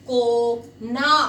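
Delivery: को ना split into two slow, clear syllables, a pause between को and ना. Shown as a demonstration. Teacher to pupils, spelling out को ना slowly. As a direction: sound out को ना slowly for the class.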